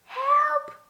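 piep om hulp als een bang muisje; mouse squeak for help. A narrator's reading. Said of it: "piepte hij heel zachtjes". (hoog piepend hulpgeroep)

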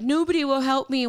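high whiny complaining. Retold or imitated. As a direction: whine it like a sulky newbie. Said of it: "Like that whiny voice".

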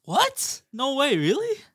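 high, squeaky mock disbelief; surprised. Acted scripted surprised reaction.

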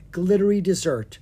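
ordinary delivery, nothing marked

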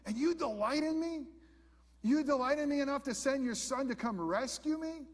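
high, marvelling cry of disbelief; amazed disbelief. Retold or imitated. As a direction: lift into astonished wonder at being loved.

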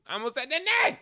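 high, loud yelp of panic; panicked yell. Real, not acted. Catching his own slip about the teacher's name with a loud yelp. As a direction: blurt it out in alarm at the slip. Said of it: "oh, I almost said the name".

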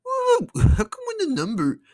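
high, squeaky mock-confused voice; mock bafflement. Vlogger mocking vote complaints, squeaky faux-confused. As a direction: play dumb about numbers going up, sarcastic.

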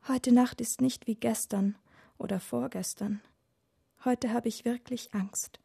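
put-on soft, whimpering child's murmur; tearful murmur. A narrator's reading. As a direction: murmur it close to tears. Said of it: "murmelt Lena mit weinerlicher Stimme".

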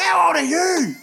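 high loud mocking yell; mocking shout. Mocking the teen gamer by yelling his question back.

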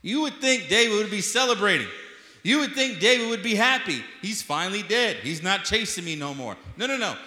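high, loud, rising sermon climax; full-force preaching. Acted. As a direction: drive it home to the congregation at full volume.

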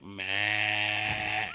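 long drawn-out bleating noise; random noise. Retold or imitated. Hunter trying to scare off a buck, random bleat. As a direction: imitate a silly noise to startle an animal. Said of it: "start making random noise".

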